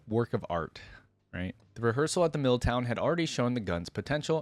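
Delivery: plain reading voice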